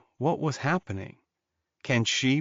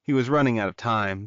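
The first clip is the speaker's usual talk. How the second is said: plain reading voice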